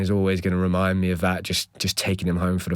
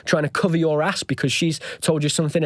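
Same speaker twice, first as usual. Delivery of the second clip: higher-pitched, retold accusatory questions; exasperated challenge, pitched up; angry questioning; 7 semitones higher than usual. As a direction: fire off indignant questions without shouting. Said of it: "I'm getting so angry".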